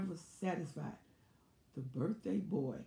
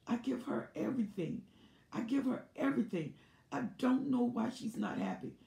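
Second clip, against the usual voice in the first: wounded victim act; 6 semitones higher than usual. Retold or imitated. Narcissist complaining to guests.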